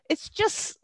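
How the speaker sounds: high, soft, choked-up voice; real happy tears welling up, barely getting words out; about to cry. Real, not acted. Podcaster moved by the park ranger's joy, near tears. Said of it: "I'm gonna cry now".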